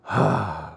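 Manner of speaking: long low sigh; deep sigh. A narrator's reading. Pinocchio torn about leaving, deep sigh. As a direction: let out a heavy sigh. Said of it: "sighed deeply".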